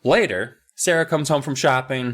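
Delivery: usual speaking voice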